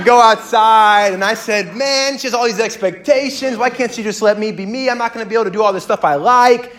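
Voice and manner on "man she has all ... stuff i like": high, worked-up mock complaint; whiny venting that speeds up and gets louder; exasperated venting. Groom venting to his mentor about her expectations, exasperated.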